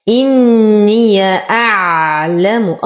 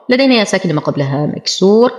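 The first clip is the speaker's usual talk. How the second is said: usual speaking voice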